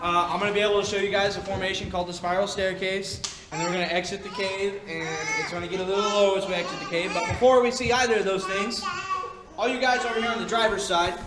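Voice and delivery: ordinary talk, nothing marked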